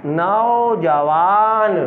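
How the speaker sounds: drawn-out word for a class; slow blended word. Shown as a demonstration. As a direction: stretch each syllable of नौजवान slowly. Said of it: "teacher blends syllables slowly".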